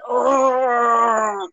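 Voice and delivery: long, high, drawn-out groan; imitated stretching groan. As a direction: groan through a big exaggerated stretch. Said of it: "stretching real hard".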